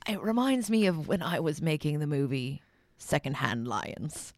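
a light mock Michael Caine accent; Michael Caine impression. Co-host reminisces in a mock Michael Caine accent. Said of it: "let's talk like Michael Caine".

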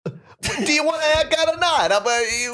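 very high, put-on impatient barber voice. Barber demanding whether the customer wants a haircut. Barber character in improv skit.

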